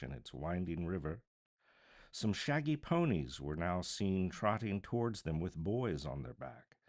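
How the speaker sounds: ordinary delivery, nothing marked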